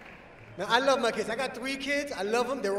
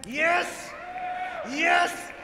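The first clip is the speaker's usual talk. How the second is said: triumphant shout; 7 semitones higher than usual. Acted. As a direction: cheer like you just won. Celebrating failed pregnancy test.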